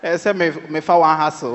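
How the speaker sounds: ordinary delivery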